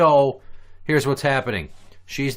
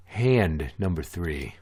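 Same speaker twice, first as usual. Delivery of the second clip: announcer voice; 7 semitones lower, slower than usual. Acted. Low slow game announcement.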